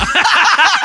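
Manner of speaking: bursts out laughing high and loud; real laugh cracking out high; high burst of laughter. Real, not acted. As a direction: cracks up at his own bit. Laughs at own accent.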